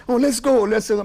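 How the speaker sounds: urgent pleading. Retold or imitated. The guy urges Clive to leave, high and urgent. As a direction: beg him to leave now.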